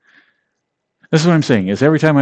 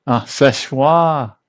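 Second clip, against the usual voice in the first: slow realizing French; 4 semitones higher, 3 dB louder, much slower than usual. Shown as a demonstration.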